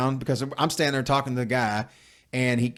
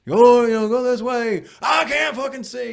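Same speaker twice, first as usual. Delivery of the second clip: high, strained mock-dad complaint; exasperated dad imitation; 9 semitones higher, 4 dB louder than usual. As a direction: mimic Dad griping in a raised, high voice. Imitating exasperated dad barking directions.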